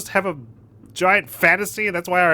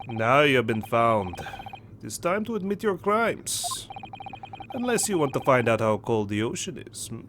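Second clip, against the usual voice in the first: menacing Russian sailor; 4 semitones lower, 6 dB quieter than usual. Acted. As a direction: threaten softly in a Russian accent. Voices the threatening sailor.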